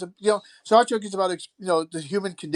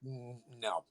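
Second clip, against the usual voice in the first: dry quiet dismissal; 5 semitones lower, 19 dB quieter than usual. Retold or imitated. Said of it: "I'm like".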